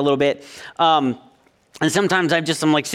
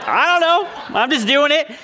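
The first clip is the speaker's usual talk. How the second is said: son's voice; 9 semitones higher than usual. Retold or imitated. Dad retelling his son's shrugging answer in a high kid voice. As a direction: play the boy with a shrug. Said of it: "he goes".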